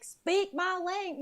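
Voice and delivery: slow, high, loud Southern drawl mocking an English-only type; a mocking Southern twang; southern accent. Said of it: "I did a southern".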